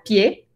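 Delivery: "pie" as French pied, without the D; silent final D. Shown as a demonstration. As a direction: say French "pie" ending on a closed E. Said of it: "se escribe pied con D al final". ("pie" sin D final, con E española)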